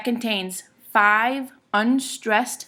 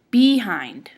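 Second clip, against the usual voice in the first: "Behind" as BE-hind, BE punched loud; BE-hind, falling away after a loud first syllable; stress on first syllable; 5 dB louder than usual. Shown as a demonstration. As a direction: hit the "Be" of "Behind", let "hind" fall. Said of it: "what would happen if we stressed be".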